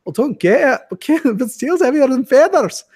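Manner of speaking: stubborn sketch character. Retold or imitated. The sketch guy insisting to people correcting him, high and stubborn. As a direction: refuse to be convinced, pitch climbing. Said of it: "this real Irish guy goes".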